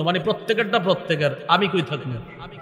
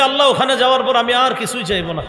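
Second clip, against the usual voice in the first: raised, eager plea with a laugh; mock eager servant; excited plea; 6 semitones higher, 3 dB louder than usual. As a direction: beg eagerly, half laughing. Preacher acting the servant's plea.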